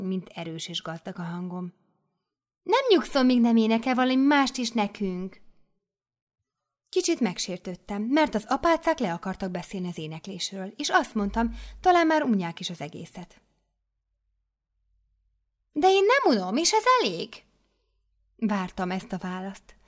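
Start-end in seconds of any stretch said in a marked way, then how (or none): 2.6-5.4 much higher, louder insistence; insistent mother superior. Acted. 15.7-17.4 much higher, louder, final; mother superior overruling. Acted.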